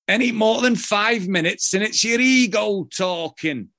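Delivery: high, loud Yorkshire voice; Yorkshire accent. Retold or imitated. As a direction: quote him in broad Yorkshire. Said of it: "let me see if I can do the Yorkshire accent".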